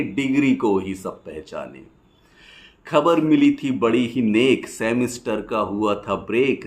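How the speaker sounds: rhythmic rhymed verse, pausing after each line; poem recitation. Acted. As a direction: recite it as lilting stage poetry.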